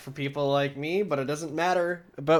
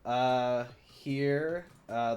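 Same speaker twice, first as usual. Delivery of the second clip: ordinary delivery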